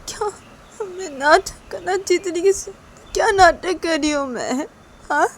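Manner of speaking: high-pitched, stung questioning; indignant mother. Acted. Mother accused of putting on an act, indignant.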